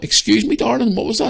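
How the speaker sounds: a high, mocking "Excuse me, darling"; sarcastic challenge. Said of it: "And I went".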